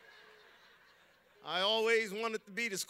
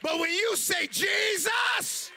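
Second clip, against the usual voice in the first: loud, high shouting sermon; full force preaching; 8 semitones higher, 6 dB louder than usual. Acted. Preacher shouting to the congregation about Jesus. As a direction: whip up the congregation.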